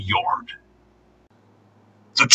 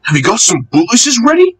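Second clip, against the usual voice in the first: higher, fast rude teasing; cheeky taunting; 11 semitones higher, faster than usual. A narrator's reading. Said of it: "ran off laughing rudely".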